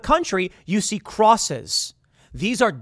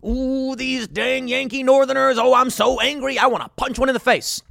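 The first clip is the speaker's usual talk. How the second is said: comic put-on outrage; hammed-up angry Southerner; 8 semitones higher, 3 dB louder than usual. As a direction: caricature a fuming man in a raised, silly voice.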